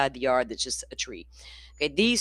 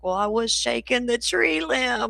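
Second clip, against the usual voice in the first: put-on high, slow little-boy confession voice; sheepish grandson; 8 semitones higher than usual. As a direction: imitate a child owning up reluctantly. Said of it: "He's like".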